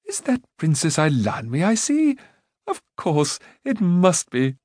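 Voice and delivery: put-on bright eager young man; lightly voiced Rune. A narrator's reading. Rune greeting Eilonwy, pleased.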